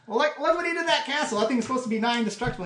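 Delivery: high, loud, rapid rant; excited outburst. Acted. Presenter marvels at the wrecked castle, excited outburst. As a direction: rattle it out in loud amazement. Character rants about dragon's destruction.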